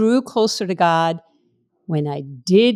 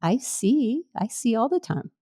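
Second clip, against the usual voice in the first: soft, higher, gentle rebuke; kindly but firm; 5 semitones higher, 4 dB quieter, slower than usual. Retold or imitated. Holy Spirit gently rebuking her, kindly but firm. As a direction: correct tenderly, with quiet firmness. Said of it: "very kindly but firmly saying".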